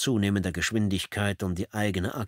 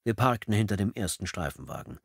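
plain reading voice

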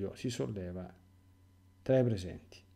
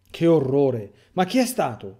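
horrified shout; 7 dB louder than usual. Acted. The disciples cry out in horror at the betrayal. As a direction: shout it out, appalled. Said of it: "urlano tutti".

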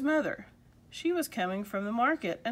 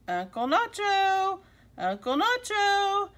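high, drawn-out call at the door; 10 semitones higher, 4 dB louder, slower than usual. A narrator's reading. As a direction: call for your uncle from outside, pitched well up. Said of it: "came a voice at the door".

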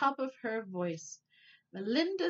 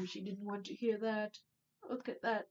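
ordinary delivery, nothing marked

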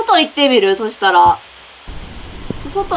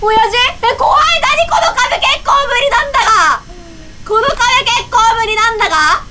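real scared shouting; 10 semitones higher than usual. Real, not acted. Player panicking at a creepy wall, high laughing shrieks. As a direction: shriek through nervous laughter. Horror scene frightens player.

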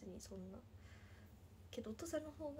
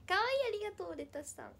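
bright, thrilled thank-you, much higher and louder; delighted thanks; 9 semitones higher, 8 dB louder, faster than usual. Real, not acted. Streamer thanking a viewer for calling her cute, delighted. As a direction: squeal thanks at a compliment. Reading a sweet comment aloud.

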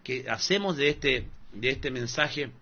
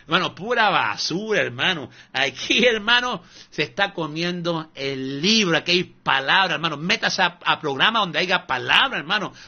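normal talking voice

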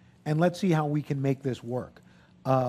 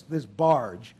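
ordinary talk

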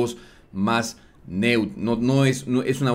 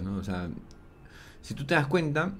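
ordinary talk

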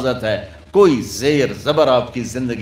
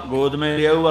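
ordinary delivery

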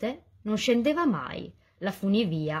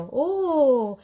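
high, loud gasp of wonder; a loud amazed oh; amazed crowd; 5 semitones higher, 6 dB louder than usual. A narrator's reading. Crowd dazzled by the glowing tree, loud amazed oh.

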